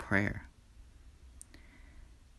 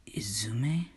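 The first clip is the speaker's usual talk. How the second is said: stunned whisper; 3 dB quieter than usual. A narrator's reading. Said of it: "And says one word".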